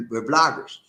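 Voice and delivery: usual speaking voice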